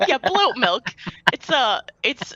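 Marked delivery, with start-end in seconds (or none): 0.1-0.9 bloat milk said as bloat, teasing; teasing flub. Retold or imitated. GM teasing a player's slip, "bloat milk" mocked. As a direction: repeat "bloat milk" mockingly, with bloat. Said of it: "You said".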